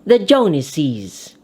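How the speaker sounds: "the Joneses" with an added -iz syllable; "Joneses" said JONE-iz-iz, ending drawn out; plural ending. Shown as a demonstration. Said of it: "And the plural".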